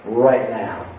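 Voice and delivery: low, firm fatherly order; stern dad. Retold or imitated. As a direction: send them home with calm authority. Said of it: "looked at them and said".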